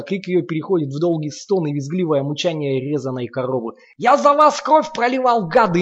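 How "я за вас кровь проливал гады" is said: much higher, louder, hoarse from yelling; hoarse raging roar. A narrator's reading. Lomov still raging at the children, hoarse roar. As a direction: keep raging, voice worn from roaring. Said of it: "не унимается Ломов, охрипший от своего рёва".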